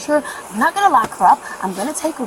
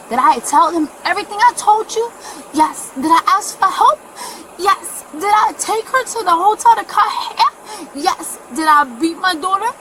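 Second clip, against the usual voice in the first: raised, loud rhetorical questions with firm answers; real heated defensiveness, voice pitched up; indignant self-defence; 6 semitones higher, 5 dB louder than usual. Real, not acted. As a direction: fire off questions and answer them yourself. Defends herself to police, self-questioning.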